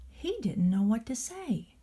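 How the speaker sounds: ordinary delivery, nothing marked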